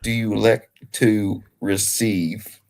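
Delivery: slow, lightly hammed Southern drawl as a coin-toss ref; Southern accent. Host playing a Southern coin-toss referee, light drawl. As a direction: drag the vowels, mock Southern official. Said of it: "that Southern accent".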